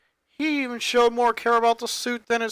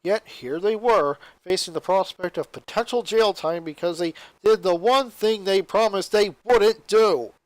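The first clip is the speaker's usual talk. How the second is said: plain reading voice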